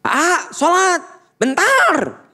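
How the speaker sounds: shouting parent; yelling. Shown as a demonstration. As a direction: shout up the stairs at the child. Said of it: "Coba lamun teriak".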